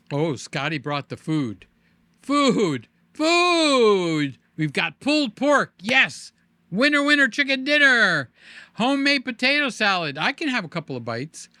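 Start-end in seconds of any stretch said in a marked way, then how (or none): 2.2-6.3 food excitedly called out, higher and louder; excited shout. Real, not acted. Food just arrived, excited. 6.7-10.2 high, loud celebratory chant; excited cheer. Real, not acted. Celebrating the food arrival.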